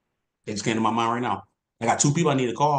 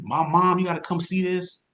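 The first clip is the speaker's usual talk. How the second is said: high, excited mock scream of a frantic caller; retold scream; 8 semitones higher than usual. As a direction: echo her panicked call, lightly. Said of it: "she called me screaming".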